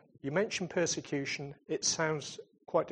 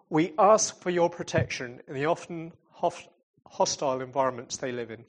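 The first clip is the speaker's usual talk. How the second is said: louder, heartfelt prayer voice; earnest prayer; 4 dB louder than usual. Real, not acted. Speaker leads the church in prayer for the persecuted, fervent. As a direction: pray aloud over the congregation, earnest.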